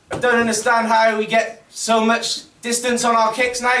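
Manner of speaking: usual speaking voice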